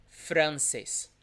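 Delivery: "Francis" as Portuguese francês, R tapped; vibrating R. Shown as a demonstration. Teacher repeating the nationality word, vibrating R. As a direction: tap the R lightly in "Francis", Portuguese style. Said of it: "vibrating, Francis".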